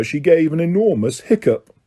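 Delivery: plain reading voice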